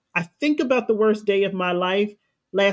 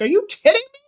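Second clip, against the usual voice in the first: high, louder, furious disbelief; exasperated outburst; 13 semitones higher, 4 dB louder, much faster than usual. Retold or imitated. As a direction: shriek it at the scared ones. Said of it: "wanted to slap the mess out of them".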